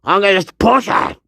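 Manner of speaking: ordinary talk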